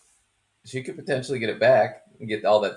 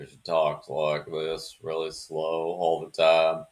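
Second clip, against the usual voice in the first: slow, lower Southern drawl, lightly done; Southern drawl impression; 5 semitones lower than usual. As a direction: drag the words out like a slow Southern lady. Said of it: "that real deep Southern".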